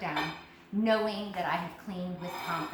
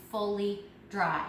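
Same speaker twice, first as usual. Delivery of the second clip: "fully dry" spaced out for emphasis; slower than usual. Real, not acted. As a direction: land firmly on "fully" and "dry", each word set apart. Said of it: "when I say that with emphasis".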